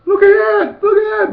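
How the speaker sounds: high, loud mock-mom squeal; mom amazed. Son imitating his mom admiring his new body, high loud squeal. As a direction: squeal like an astonished mother. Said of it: "my mom was like".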